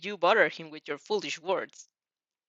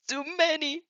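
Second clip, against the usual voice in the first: whining; 9 semitones higher than usual. A narrator's reading. Father counting his daughter's friends, mild whine. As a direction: complain like a sulky dad. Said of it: "he whines".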